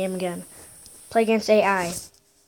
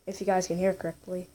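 ordinary talk

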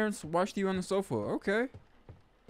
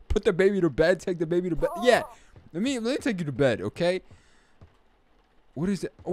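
normal talking voice

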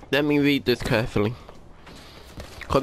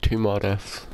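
normal talking voice

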